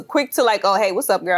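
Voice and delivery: ordinary delivery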